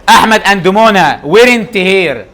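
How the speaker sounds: very slow, each word set apart; slow dictation. Shown as a demonstration. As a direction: read the sentence out slowly for the class. Teacher reading exam sentence aloud. (إملاء بطيء كلمة كلمة)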